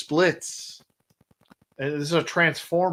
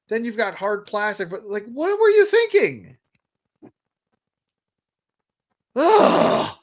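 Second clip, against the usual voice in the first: high-pitched, louder frustrated griping; pitched-up exasperation, real annoyance; exasperated rant; 7 semitones higher, 3 dB louder than usual. Real, not acted. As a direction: vent your disgust at the toy. Ranting about a bad figure.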